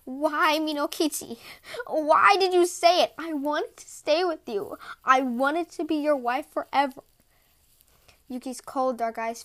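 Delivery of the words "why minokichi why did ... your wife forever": anguished. A narrator's reading. Snow woman's anguished parting cry.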